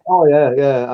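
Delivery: ordinary delivery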